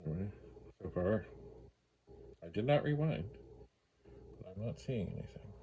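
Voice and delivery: normal talking voice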